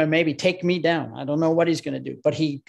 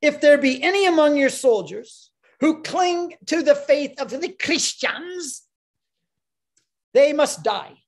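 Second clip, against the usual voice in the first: imperial decree; 10 semitones higher, 4 dB louder than usual. Acted. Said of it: "So a decree was dispatched".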